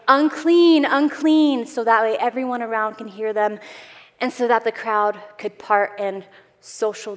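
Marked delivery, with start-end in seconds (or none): 0.1-1.7 loud, high warning call; imitated yell. Lepers warning the crowd as they approach, yelled out. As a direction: call it out for everyone around to hear. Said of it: "scream out and yell out and say".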